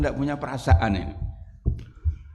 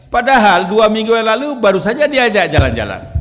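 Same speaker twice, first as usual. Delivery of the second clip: loud rebuttal; 9 semitones higher, 9 dB louder than usual. Real, not acted. Preacher rebuts loudly.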